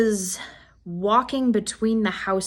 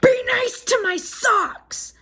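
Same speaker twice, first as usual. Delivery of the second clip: high, slow odd squeaky demand; quoting a stranger; 13 semitones higher, slower than usual. Retold or imitated. Mental facility patient's odd demand.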